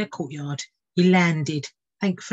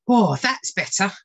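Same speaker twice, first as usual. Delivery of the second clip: relieved bird; 3 semitones higher, 5 dB louder than usual. Acted. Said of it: "said Fitzherbert".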